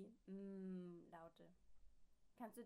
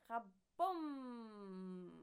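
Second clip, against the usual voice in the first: loud booming crash; explosion; 2 semitones higher, 12 dB louder than usual. A narrator's reading. Neighbour blowing up with a mighty boom. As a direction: make the explosion big.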